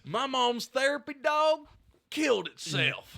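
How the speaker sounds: high, loud, drawn-out southern drawl; southern accent. Acted. Comic dumbing down jokes for the crowd, southern accent. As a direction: deliver the punchline as a hollering southerner. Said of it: "He went back into his southern accent".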